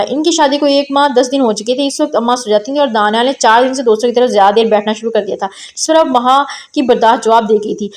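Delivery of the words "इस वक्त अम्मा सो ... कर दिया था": usual speaking voice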